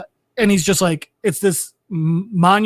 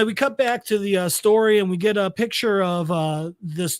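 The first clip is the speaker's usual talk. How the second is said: normal talking voice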